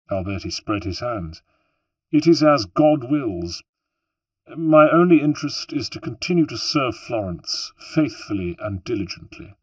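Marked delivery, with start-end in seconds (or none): 2.1-9.5 a lightly voiced smug magistrate, a bit higher and louder; proud politician mocking a runaway boy; smug official. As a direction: scoff like a self-satisfied statesman. Alberti modest to flatterers.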